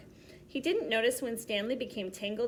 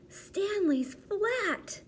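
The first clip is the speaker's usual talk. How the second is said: put-on high, very slow amazed kid voice; amazed child; 11 semitones higher, much slower than usual. Arthur staring at flat Stanley, slow amazed child voice. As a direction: draw it out in wonder, pitched up.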